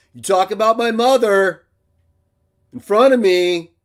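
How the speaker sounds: loud warning shout about his mother; angry shout. Real, not acted. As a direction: yell it straight at him. Threat over his mother.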